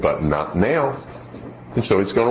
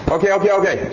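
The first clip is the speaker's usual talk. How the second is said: quick, higher-pitched okays, mildly put on; lightly voiced kid fobbing off a parent; hurried placating kid; child brushing mom off; 8 semitones higher, faster than usual. Acted child brushing off mother.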